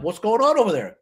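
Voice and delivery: curious shoppers. Retold or imitated. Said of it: "people are like".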